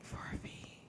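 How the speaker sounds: echoed whisper. Real, not acted. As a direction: whisper it back conspiratorially.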